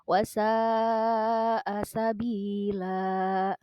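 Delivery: slow, melodic tilawah chant with drawn-out vowels; Quran recitation. Acted. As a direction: recite the verse slowly like tilawah. Teacher recites Quran verse. (lantunan tilawah Al-Quran yang lambat)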